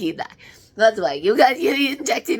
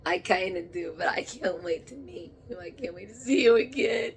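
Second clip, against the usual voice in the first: normal talking voice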